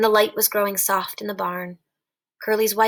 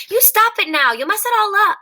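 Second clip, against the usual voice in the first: high, loud angry cry; 11 semitones higher, 7 dB louder than usual. Acted. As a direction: cry out in anger, pitch way up. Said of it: "she cried angrily".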